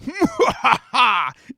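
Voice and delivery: loud, high evil cackle; maniacal demon laughter. Acted. Demon gets its Red Bull, laughing maniacally. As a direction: let rip a villain's triumphant laugh. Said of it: "filled the night air with maniacal laughter".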